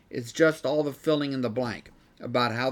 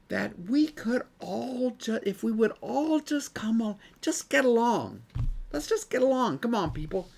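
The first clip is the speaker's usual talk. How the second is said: higher-pitched, earnest mock appeal; naive pleading; 8 semitones higher than usual. Preacher voicing naive idealists to his class, higher mock plea. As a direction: imitate well-meaning idealists urging everyone on.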